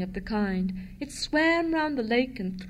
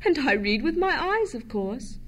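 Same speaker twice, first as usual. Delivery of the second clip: ordinary delivery, nothing marked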